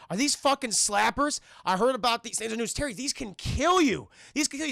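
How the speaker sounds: high, loud scandalized mom; alarmed mom. Retold or imitated. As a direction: voice a mother scolding in alarm. Acted alarmed mom finding drug patches.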